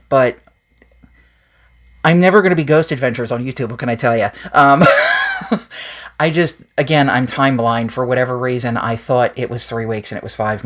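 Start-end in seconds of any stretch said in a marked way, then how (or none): 4.9-6.2 breathy laugh. Real, not acted. Laughing at own excuse.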